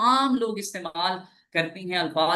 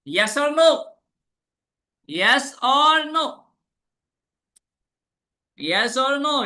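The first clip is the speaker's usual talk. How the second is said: high, loud yes-or-no call; 7 semitones higher, 6 dB louder, much slower than usual. Real, not acted. Teacher calls out to wake up students. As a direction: demand an answer from the class. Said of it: "और भाई जाग रहे हैं ना?".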